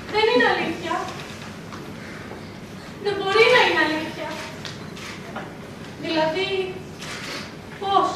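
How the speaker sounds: hushed, halting, with long pauses; stunned disbelief. Acted. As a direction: barely voice the shock, piece by piece. Daughter stunned by the revelation.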